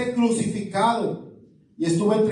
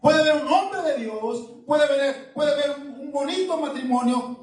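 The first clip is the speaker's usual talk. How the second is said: higher, impassioned preaching tone; fervent preaching; 6 semitones higher than usual. Real, not acted. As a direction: preach it with lifted fervor. Preaching at raised pitch. (predicación fervorosa, con el tono elevado)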